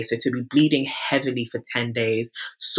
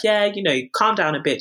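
lightly put-on higher doctor voice; imitated placating doctor; 8 semitones higher than usual. GP asking patient to calm down, imitated placating voice. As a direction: mimic a doctor soothing a patient.

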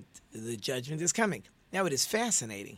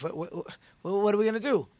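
stammered, rising into a higher, louder cry; panicked client; 6 semitones higher, 5 dB louder, faster than usual. Acted. Accused man pleading with his absent lawyer, acted panic. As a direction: play the accused man losing his nerve on the phone.

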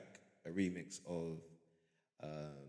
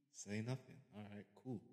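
usual speaking voice

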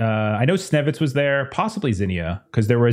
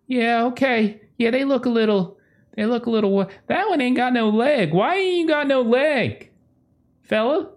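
high-pitched, hesitant folksy call; tentative and curious, voice pitched up; wary slender woman Tex; 11 semitones higher than usual. Acted. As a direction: play a puzzled woman checking on strangers. Tex questions the crew.

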